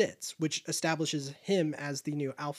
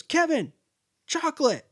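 high, sing-song lure; coaxing call; 11 semitones higher, 5 dB louder than usual. Retold or imitated. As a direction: tempt the bird with a treat. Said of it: "He goes".

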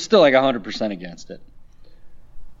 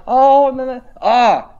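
choked-up squeal; 10 semitones higher, 6 dB louder, slower than usual. Retold or imitated. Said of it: "I was like".

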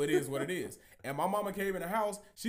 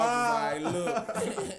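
laughing while quoting himself; cracking up mid-quote; 5 semitones higher, 4 dB louder, much slower than usual. Real, not acted. Laughing retelling call to mom.

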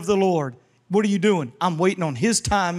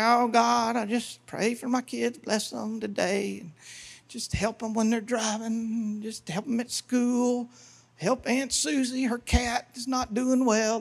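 soft monotone murmur of a routine prayer list; mild, rote prayer; 3 semitones higher, 6 dB quieter than usual. Acted. Preacher mimics someone running down a prayer list, soft and listless. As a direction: pray it softly, higher and droning, no urgency. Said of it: "you gotta throw that out. This is what it sounds like".

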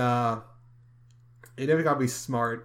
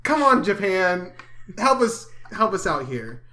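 pleading; 6 semitones higher, 6 dB louder than usual. Real, not acted. Mock plea to Japan.

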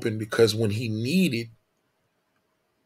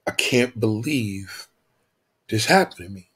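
tearful; 3 dB quieter than usual. Acted. Man in tears after the pastor offers help, mildly tearful. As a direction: lightly act overwhelmed, with long pauses. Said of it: "He's in tears".

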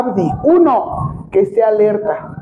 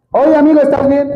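loud, high call to a collapsed person; 5 semitones higher, 3 dB louder than usual. Shown as a demonstration. Instructor shows how to call a collapsed person, loudly. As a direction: call out firmly to get a response. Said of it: "¿Le hablé o no le hablé?".

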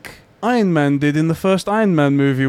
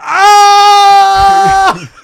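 high, loud drawn-out scream; slow-motion scream; 11 dB louder than usual. Retold or imitated. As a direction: yell it like a hit in slow motion. I like to imagine he's like.

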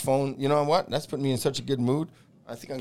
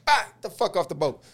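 higher, heated curse, half acted; retold angry outburst; 5 semitones higher than usual. Boarding officer curses the assistant off the boat, heated. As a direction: snap it out as he grabs you.

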